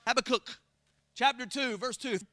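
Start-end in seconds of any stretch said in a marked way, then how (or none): none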